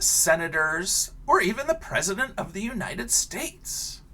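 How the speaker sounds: lively picture-book read-aloud; reading to children. Acted. Teacher reading a picture book to her class, animated storytelling.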